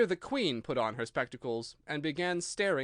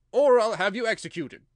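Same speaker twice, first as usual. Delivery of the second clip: higher, louder stern King; a king scolding his subject; loud reproving royal voice; 7 semitones higher, 12 dB louder than usual. A narrator's reading. King threatens.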